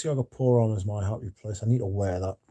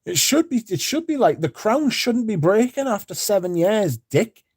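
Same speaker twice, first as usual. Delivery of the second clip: furious rant; 11 semitones higher than usual. Retold or imitated. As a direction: vent at the shop like you've been robbed. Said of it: "I was like".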